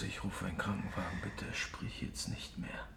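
whisper. Acted. Begging wounded Bones not to speak, whispered. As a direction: hush her gently while fearing the worst. Partner bleeding, urgent hush.

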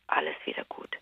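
whispered, barely voiced alles wieder gut; whispered relief. Narrating the car fix to a friend, whispered. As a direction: hush it like a secret relief. Radio works again, relief.

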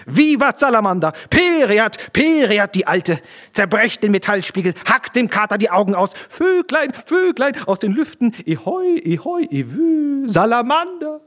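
very high, loud frenzied chanting; screaming like possessed. A narrator's reading. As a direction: shriek the toast like madmen running wild. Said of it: "schrien und brüllten die drei wie Besessene". (wie Besessene geschrien)

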